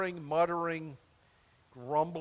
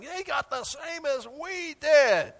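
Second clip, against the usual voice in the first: higher, slow whiny complaint; whining grumbler; 8 semitones higher, 4 dB louder, slower than usual. Retold or imitated. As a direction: whine it like a jealous worker. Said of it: "They're muttering".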